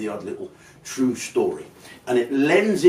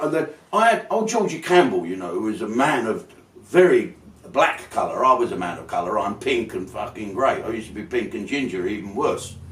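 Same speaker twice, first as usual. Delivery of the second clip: ordinary delivery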